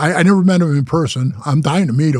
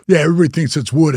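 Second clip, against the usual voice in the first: normal talking voice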